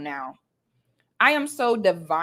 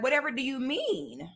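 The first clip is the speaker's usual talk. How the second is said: mocking prissy voice; 5 semitones higher, 7 dB quieter than usual. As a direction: lift it up and play it dainty and fake-innocent. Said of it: "Something about this was like".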